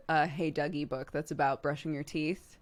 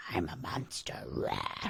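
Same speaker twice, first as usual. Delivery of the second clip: much lower, growling monster voice; 13 semitones lower, 5 dB quieter, slower than usual. Shown as a demonstration. Pretending to scare Clark, a low growling monster. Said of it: "if we're like".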